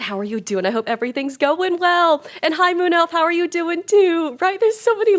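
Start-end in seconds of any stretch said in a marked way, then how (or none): 1.4-5.2 high, loud, bright hello to a viewer; excited greeting. Real, not acted. Streamer greeting a chat viewer, excitedly high and loud. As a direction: squeal hello at a friend in chat.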